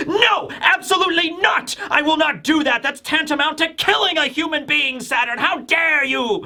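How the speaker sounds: high-pitched mock outrage; outraged retelling. Vegapunk refusing Saturn's order, acted pissed off. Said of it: "one of the most pissed off Vegapunks".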